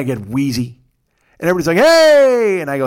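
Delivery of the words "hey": big high shouted hey; loud greeting. Retold or imitated. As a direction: whoop it like a room full of friends. Party greets him arriving.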